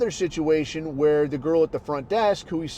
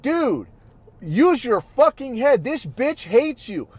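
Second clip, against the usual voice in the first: higher, slow scolding rebuke; retold scolding; 6 semitones higher, 3 dB louder, slower than usual. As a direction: shake sense into a buddy. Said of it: "And I was like".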